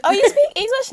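high, patronising question; mock interviewer. Mocking interviewers asking Korean artists about English, high patronising question. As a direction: ask it with fake sweetness.

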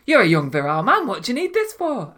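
high, fast mock mum; incredulous mum scolding; mum's voice. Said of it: "That was it".

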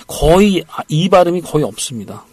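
usual speaking voice

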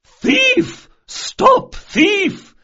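very high, loud alarm cry; strong holler. A narrator's reading. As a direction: holler for the whole street to hear. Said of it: "she hollered in a voice of surprising strength".

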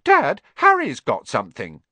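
high, loud tattling; sudden outburst. A narrator's reading. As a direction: tell on someone urgently to dad. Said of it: "said Dudley suddenly".

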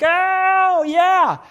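high, loud ecstatic cry; blissful. Retold or imitated. Speaker imagines being filled with the Spirit, high and ecstatic. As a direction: gush like you're floating with joy. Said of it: "I'm like".

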